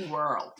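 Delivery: "World" as one syllable, "wurld"; refined one-syllable "World"; posh Scottish pronunciation. Shown as a demonstration. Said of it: "posh Scots who say world".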